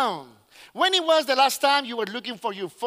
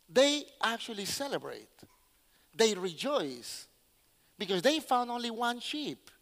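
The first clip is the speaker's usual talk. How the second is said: hushed wonder; 8 dB quieter than usual. Real, not acted. Preacher marvels that they rejoiced over one sheep, hushed. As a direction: drop the volume to let it sink in.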